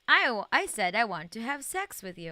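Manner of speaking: loud, falling read of the game line. Shown as a demonstration. Streamer reading the first dialogue choice aloud, loud. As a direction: belt the dialogue option out. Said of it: "number one, let's go".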